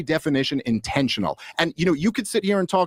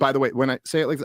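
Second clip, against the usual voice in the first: fast; 2 semitones lower, much faster than usual. Real, not acted. Clip quoted fast.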